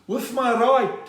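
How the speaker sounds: high, slow boxer proudly recounting; impression of Gerry Coetzee. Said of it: "How did you do it, Gerrie?".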